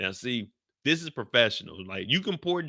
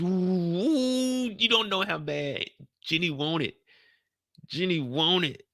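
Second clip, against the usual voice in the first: high, drawn-out teasing voice; mock eager Jenny; 6 semitones higher, slower than usual. Teasing a friend about Jenny, high and drawn out. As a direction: stretch it out, higher, mocking how badly she wants it.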